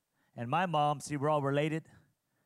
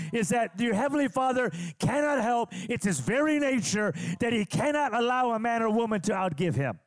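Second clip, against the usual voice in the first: full-force preaching; 6 semitones higher than usual. Acted. Pastor preaching giving to congregation, rising to full force.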